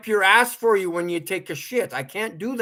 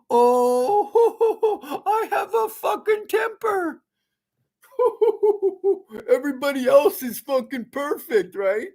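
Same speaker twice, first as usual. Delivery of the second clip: higher, laughing through the words; 11 semitones higher than usual. Real, not acted. Vlogger laughs at his own temper after an outburst: laughing. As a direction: laugh it off at yourself.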